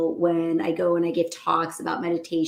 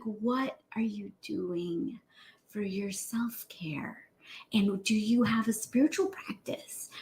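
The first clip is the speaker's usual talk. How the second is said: slow, soft whispered questions; gentle whisper; 2 semitones higher, 8 dB quieter, slower than usual. Retold or imitated. Doctor softly asks the crying patient about self-care, whispering. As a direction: ask gently, barely voiced.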